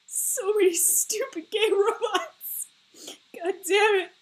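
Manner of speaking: high, drawn-out mock whine; mock despair. Mock despair over robot obsession.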